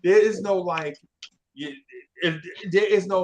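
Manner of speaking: ordinary talk, nothing marked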